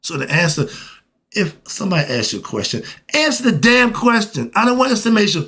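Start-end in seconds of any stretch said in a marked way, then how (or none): 3.1-5.5 raised, insistent exasperation; exasperated demand. Acted. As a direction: demand a straight answer, fed up. Acted exasperated questioner.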